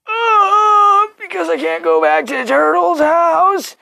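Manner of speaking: high, whimpering whine; whiny. Retold or imitated. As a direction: plead like a child about to cry. Whiny reply to mom.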